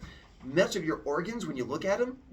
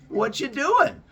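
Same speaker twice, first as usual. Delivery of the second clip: slow, higher, louder buddy greeting; friendly Prometheus; 6 semitones higher, 8 dB louder, slower than usual. Acted. Prometheus approaching the humans, slow cheery greeting. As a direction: greet them like a cheerful big brother. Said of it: "He's like".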